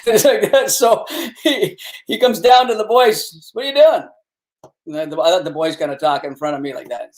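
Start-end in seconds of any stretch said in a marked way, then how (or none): 3.5-4.0 retold cop's challenge, pitched up; intimidating cop. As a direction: lightly play the big cop questioning the boys. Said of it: "intimidating bastard".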